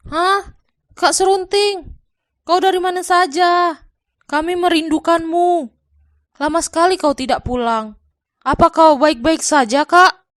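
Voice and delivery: high, loud, startled greeting; surprised Arya. Acted. Arya welcomes his long-gone brother, high and surprised.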